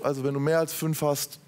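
usual speaking voice

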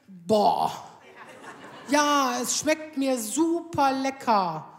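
a drawn-out, reluctant husband voice with slow lines; grudging husband. Retold or imitated. Husband forced to praise her cooking, grudging and slow. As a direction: drag the praise out unwillingly.

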